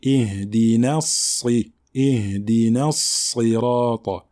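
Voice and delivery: slow "Ihdina Sirata", light "na" into heavy S; balanced Nun and Sad. Shown as a demonstration. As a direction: balance thin "na" against emphatic S in "Ihdina Sirata". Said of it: "faire l'équilibre entre ces deux lettres".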